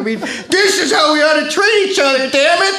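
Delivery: high, cracking-up emotional confession; hammed-up weepy pleading; tearful outpouring. Guest tells host of being held by a man, tearfully.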